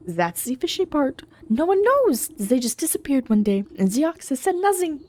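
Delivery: put-on German-accented farmer sharing a suspicion; gossiping rival farmer.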